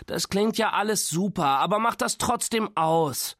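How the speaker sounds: high, groaning complaint; exasperated, high-pitched moan. A narrator's reading. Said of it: "stöhnte Nora".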